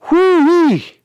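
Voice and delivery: high, drawn-out whoo-wee; high whooping exclamation. Real, not acted. Sports host marvels at a ball sailing downfield, high whoop. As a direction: let out a high whistle-like whoop.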